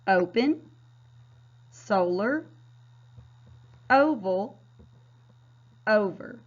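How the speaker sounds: each word said slowly and clearly on its own, long O in the first syllable; modeled reading. Shown as a demonstration. Teacher models practice words one by one for students, clearly. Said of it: "Let's practice a few of these words".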